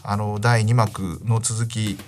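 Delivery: ordinary talk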